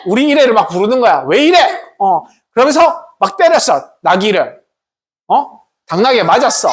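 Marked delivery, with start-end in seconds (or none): 1.3-1.6 rising, higher angry yell at the donkey; annoyed rider. Acted. Balaam yelling at his balking donkey, higher and angry. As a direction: snap at a stubborn animal. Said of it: "발람이 처음에 막".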